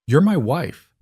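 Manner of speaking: firm interjection, pitched high; cutting in. A narrator's reading. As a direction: cut in to assert your point. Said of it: "Damien cut her off".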